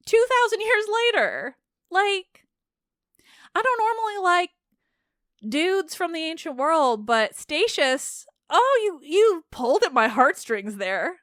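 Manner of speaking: overwhelmed with delight at the poet; real giddy excitement; excited. Real, not acted. Gushing about the ancient poet.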